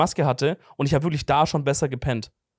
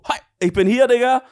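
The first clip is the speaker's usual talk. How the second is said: high, loud, pumped-up greeting; hyped entrance; 8 semitones higher, 5 dB louder than usual. Retold or imitated. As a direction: burst in full of energy. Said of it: "Ich komm auch immer so".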